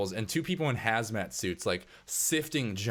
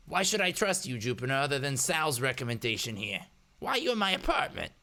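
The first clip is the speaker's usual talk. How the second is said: raised-pitch, questioning character voice; hacker Eddie, suspicious; 6 semitones higher than usual. Acted. Hacker questioning an uninvited stranger, higher suspicious character voice. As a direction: size up the stranger, rising to a sharp demand.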